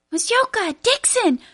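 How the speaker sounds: shouting names from afar; yelling. A narrator's reading. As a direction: call out to brothers loudly. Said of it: "Charles yelled".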